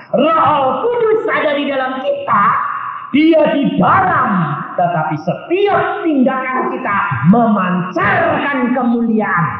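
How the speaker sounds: high, ringing sermon delivery; full-force preaching. Real, not acted. Preacher warns congregation about masks, proclaiming forcefully.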